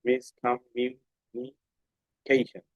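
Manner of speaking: Miscommunication stretched out very slowly, each syllable drawn out; very slow reading. Shown as a demonstration. Teacher reading the long word very slowly for the class. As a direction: spell out miscommunication at a crawl, syllable by syllable. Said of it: "দেখো তো এখানে ঠিক আছে কিনা".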